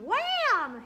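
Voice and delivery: a sudden loud, high wham. Shown as a demonstration. Teacher showing students the hard strum, loud and high. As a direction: slam the word out like a big strum.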